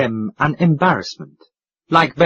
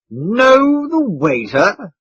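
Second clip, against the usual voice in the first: higher, very slow and offended; indignant; 8 semitones higher, 3 dB louder, much slower than usual. A narrator's reading. Mister Audley answers the proprietor indignantly. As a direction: drag it out, affronted. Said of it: "answered Mister Audley indignantly".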